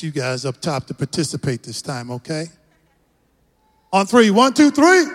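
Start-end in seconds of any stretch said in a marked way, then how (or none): 3.9-5.0 loud count climbing high to three; rally them with a rising shout. Real, not acted. Pastor cueing the whole congregation to yell, shouted and rising.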